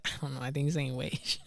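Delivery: quiet mumble. Retold or imitated. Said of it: "she was like".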